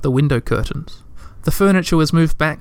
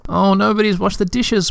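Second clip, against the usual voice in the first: wretched; 6 semitones higher than usual. A narrator's reading. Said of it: "cried wretchedly".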